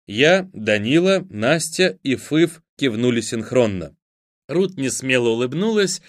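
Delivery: plain reading voice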